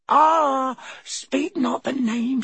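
high, slow moan of dread; moaning elf. A narrator's reading. Dobby covers his ears at the name, high slow moan. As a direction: plead against the dreaded name.

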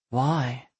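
husky. A narrator's reading. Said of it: "her husky voice said into my ear".

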